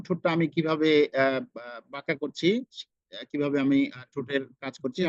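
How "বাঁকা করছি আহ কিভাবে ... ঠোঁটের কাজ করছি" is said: usual speaking voice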